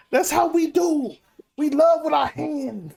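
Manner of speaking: over-the-top fake Italian accent; bad Italian accent. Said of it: "That was a horrible Italian accent".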